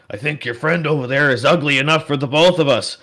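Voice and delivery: loud, high, jeering insult; the orc jeers at the party, loud and mocking. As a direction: toss the insult loudly across the room. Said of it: "he just goes".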